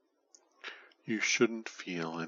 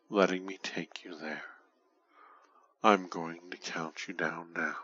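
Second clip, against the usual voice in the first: normal talking voice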